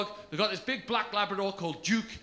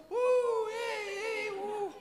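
mock crowd cheer; 12 semitones higher, 7 dB quieter, much slower than usual. Imitating cheering cat fans.

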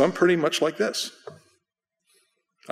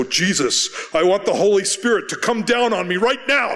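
raised-voice prayer; 7 semitones higher, 4 dB louder than usual. Shown as a demonstration. Preacher calling down the Holy Spirit, raised urgent voice. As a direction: voice climbing high into an emphatic demand. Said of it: "raising my voice and go".

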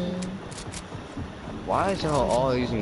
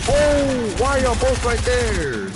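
high furious yelling; raging gamer; 11 semitones higher, 5 dB louder than usual. Real, not acted. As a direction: scream at the game.

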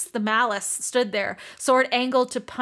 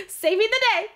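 Saving the day squealed much higher and a bit louder; fangirling; 11 semitones higher, 4 dB louder than usual. Real, not acted. Fan cheering Rune on, Saving the day squealed high. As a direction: gush it like a thrilled fan. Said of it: "I'm done fangirling".

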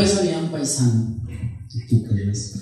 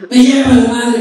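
loud, higher-pitched comic curse; exasperated priest; 5 semitones higher, 6 dB louder than usual. Acted. Priest cursing his missed shot, exasperated. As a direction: blurt out a frustrated oath.

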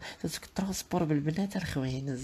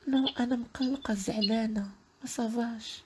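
high girlish voice, mock tired; mock sad girl; 8 semitones higher than usual. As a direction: whine high like a weary girl. Put-on upset girl voice.

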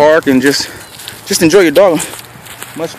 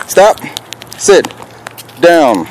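firm command; 4 semitones higher, much slower than usual. Real, not acted. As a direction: snap out each command. Commanding dog.

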